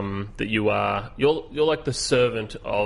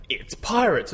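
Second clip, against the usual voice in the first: dramatic; 8 semitones higher, slower than usual. Acted. Dramatic game text read out.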